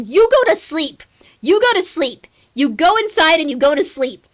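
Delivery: loud, high, insistent yelling; repeated yelled command; shouting. Acted. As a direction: order them to bed at full volume.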